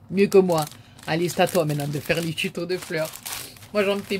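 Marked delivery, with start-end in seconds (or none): 0.1-2.3 a sudden bright, loud compliment; loud teasing. Real, not acted. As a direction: call it out to tease her friend. Teasing friend about her flowers.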